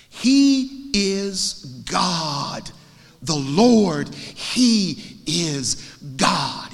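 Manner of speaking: very slow, loud declaration; acted proclamation. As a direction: stretch each word as an awed crowd's cry. Crowd's cry acted from scripture.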